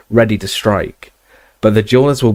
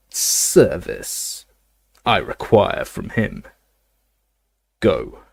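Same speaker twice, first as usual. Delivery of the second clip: cold dark lord; much slower than usual. Acted. Voldemort commands Lucius.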